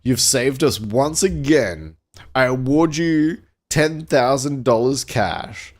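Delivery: lightly voiced president handing out an award; mildly grand, deliberate proclamation; a hint of ceremonial pomp; president addressing hero. A narrator's reading.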